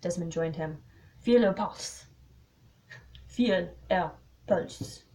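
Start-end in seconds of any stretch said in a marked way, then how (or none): 1.2-2.0 put-on louder order in a French accent; lightly French-accented detective. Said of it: "The French accent". 3.2-5.0 very slow "Feel her pulse", word by word; halting French-accented retake; slow, careful retake. Shown as a demonstration. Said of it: "It's hard to say".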